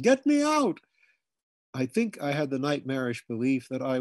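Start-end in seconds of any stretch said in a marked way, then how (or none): 0.0-0.8 high, loud panicked bawl. A narrator's reading. Boy trapped under his bed bawls for help. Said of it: "I bawled".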